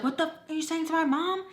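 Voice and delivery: fast, high-pitched mock outrage; imitated angry teen. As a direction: rush it like a teen defending mom. Retold teen confronting through door.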